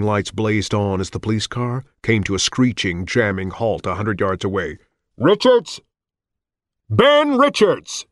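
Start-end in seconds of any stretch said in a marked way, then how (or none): 5.2-8.0 high, loud, slow megaphone call. Acted. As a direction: boom it through a megaphone. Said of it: "Gigantic megaphone booming voice".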